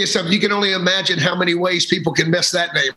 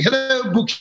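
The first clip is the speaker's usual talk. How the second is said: higher, Irish lilt on Hello, Bucky; Irish students saying hello, Irish accent; 8 semitones higher than usual. Retold or imitated. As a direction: do his Irish students greeting him. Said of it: "vocal students I work with from, uh, Ireland".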